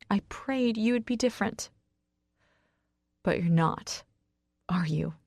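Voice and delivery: dropping into quiet disappointment; hurt disappointment. A narrator's reading. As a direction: let the hope drain away. Outburst sinks into disappointment.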